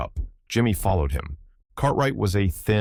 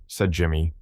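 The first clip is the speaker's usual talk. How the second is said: usual speaking voice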